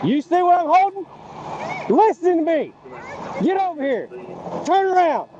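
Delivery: calling from far away. Real, not acted. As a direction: call each command across the gap. Officer calling to suspect at distance.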